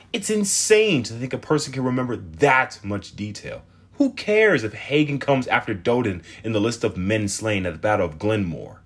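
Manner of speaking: put-on a fed-up girl venting; complaining teen girl. Teen girl voiced in story.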